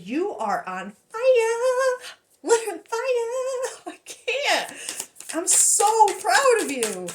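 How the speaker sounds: high, squealing, laughing praise; thrilled, high-voiced cheering; gushing pride. Real, not acted. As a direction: gush with pride, pitched right up.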